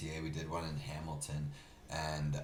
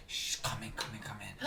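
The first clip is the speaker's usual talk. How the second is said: imitated hushed beckoning, higher pitched; furtive whisper; 4 semitones higher than usual. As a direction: whisper someone secretly through the door. Woman furtively beckons him inside.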